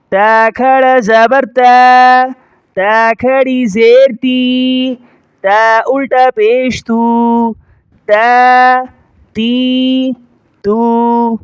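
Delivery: slow drill, "تا، تی، تو" held long and level; drawn-out vowels. Shown as a demonstration. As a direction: stretch each of "تا", "تی", "تو" for one alif. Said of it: "ایک الف کے بقدر کھینچا جائے گا". ("تا، تی، تو" کو کھینچ کر)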